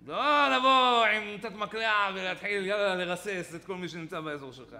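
emphatic warning. Shown as a demonstration. Teacher warns against rebuking like a machine gun, emphatic warning. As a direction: stretch it out loudly in protest.

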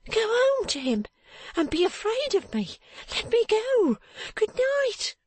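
very high woman's voice, urging; high-pitched character voice, agitated; distraught strange woman. Acted. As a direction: send the child away in a high, urgent voice.

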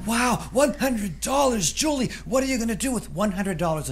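high, loud, wide-eyed wonder; amazed mom. Acted. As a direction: gasp it out like a stunned mother.

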